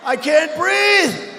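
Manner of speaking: hammed-up choking rival; mock gasping. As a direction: stretch it out like he's out of air. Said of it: "He's going".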